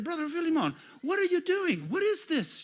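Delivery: normal talking voice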